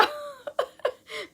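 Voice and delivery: amused laugh. Real, not acted. Telling friends about the book club kids, amused laugh. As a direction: laugh out, charmed by the answer.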